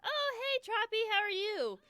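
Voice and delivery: bright high call to a newcomer; excited hello to a viewer joining the stream; excited greeting. Real, not acted. Greeting a viewer arriving in chat.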